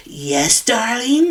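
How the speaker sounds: lightly voiced creepy crone, lilting up at the end; hint of a sinister old lady answering sweetly. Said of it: "this creepy old lady".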